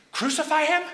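high, loud, rising disbelief; alarmed question. Acted. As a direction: echo the shout as a startled question. Prisoner questions what he hears.

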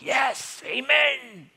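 high, rousing "yes, amen"; fervent cheer. Acted. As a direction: cheer like a fired-up congregation. Acting a congregation cheering the story.

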